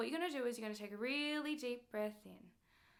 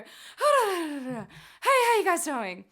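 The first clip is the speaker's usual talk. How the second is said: out of breath; 5 semitones higher, 12 dB louder, much slower than usual. Retold or imitated. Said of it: "you're puffed".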